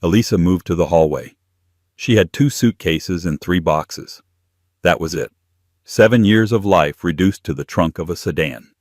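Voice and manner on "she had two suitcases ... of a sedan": usual speaking voice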